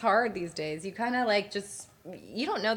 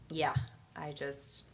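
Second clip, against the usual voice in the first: whispering; 6 semitones lower, 9 dB quieter, slower than usual. Real, not acted. Mortified, trailing off.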